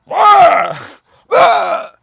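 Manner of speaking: put-on high, loud strained old-man yelp; Al Pacino yelling. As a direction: blurt out a sudden Pacino-style yell. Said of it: "He does that Pacino voice that he does".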